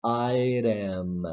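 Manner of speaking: "item" with a tapped t; listen to the flap in "item"; modelled "item" with a flap T. Shown as a demonstration.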